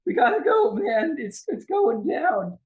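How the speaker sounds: very high, loud panicky voice; scared shouting. Acted. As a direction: squeal an alarmed warning, stretched at the end. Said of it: "like he's scared to jump".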